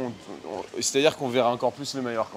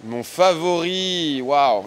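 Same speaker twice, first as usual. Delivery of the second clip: surprised; 5 semitones higher, 8 dB louder, much slower than usual. Real, not acted. Stunned by the question.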